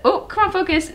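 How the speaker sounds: ordinary talk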